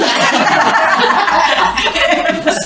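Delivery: loud, high burst of laughter. Real, not acted. A preacher laughing at his own story to the church, loud and high. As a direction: crack up at yourself.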